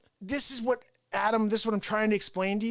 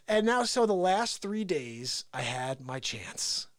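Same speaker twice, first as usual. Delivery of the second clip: ordinary talk, nothing marked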